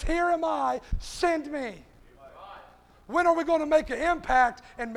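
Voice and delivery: rising sermon cadence with loud punched words; preaching. Acted. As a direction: call the church to stand in the hedge. Preaching the invitation to the church.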